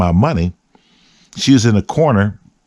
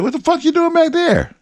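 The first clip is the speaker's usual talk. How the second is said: incredulous. Retold or imitated. Finds friend hiding in bush.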